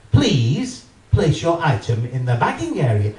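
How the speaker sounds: firmer, insistent automated announcement. Retold or imitated. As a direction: make the machine sound impatient. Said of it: "A bit more forceful this time".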